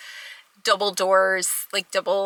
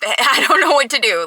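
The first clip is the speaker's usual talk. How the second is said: a frustrated laugh breaking into the words, higher and louder; exasperated laugh; 5 semitones higher, 5 dB louder than usual. Retold or imitated. Retelling her fed-up message to the nurse, exasperated laugh. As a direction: laugh in disbelief at being stuck. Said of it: "increasing in frustration along the way".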